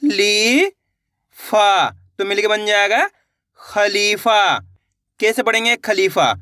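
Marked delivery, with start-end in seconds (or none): 0.0-1.9 drawn-out syllable by syllable; sounded out slowly. Shown as a demonstration. Teacher sounding out a word for pupils, slowly drawn out. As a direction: stretch each sound for the pupils. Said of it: "चलिए देखिए".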